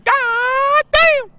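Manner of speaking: very high, loud squeaky God damn; high-pitched God damn. Retold or imitated. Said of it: "my high-pitched voice".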